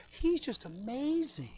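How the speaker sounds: a hint of a soft, slow adoring wife; lightly voiced doting wife. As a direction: gush over him softly, slowly. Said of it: "she's got these glowing eyes".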